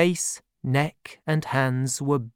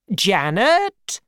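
loud, high call across the house; aunt shouting for her servant; shouted name; 7 semitones higher, 5 dB louder than usual. Acted. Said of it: "shouted my aunt".

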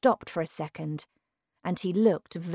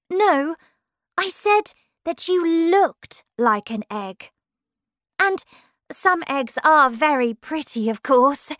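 put-on higher, louder girl character voice; polite Alice; 9 semitones higher, 7 dB louder than usual. Alice placating an offended Humpty Dumpty, politely, in a higher voice. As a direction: play Alice, politely placating in a raised pitch.

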